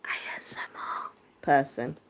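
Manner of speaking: slow, soft ASMR whisper; playful whisper. Pretend. Joking to an ASMR fan in chat, slow whisper. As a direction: breathe it out like an ASMR video. Greeting a fellow ASMR viewer.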